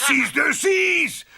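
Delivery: high, slow shout with a laugh. Real, not acted. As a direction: call out across the set, laughing. Coach cheering from sidelines. (cri joyeux et lent)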